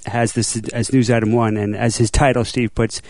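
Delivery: ordinary talk, nothing marked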